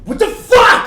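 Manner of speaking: sudden high enraged yell; furious outburst. Retold or imitated. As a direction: explode like a tyrant losing his game. Hitler freaks out at players.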